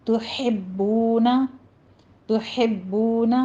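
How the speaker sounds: slow model reading of تحبون; tajweed pronunciation. Shown as a demonstration. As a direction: stretch out تحبون slowly, ha kept light. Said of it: "ہا باریک رکھنا ہے".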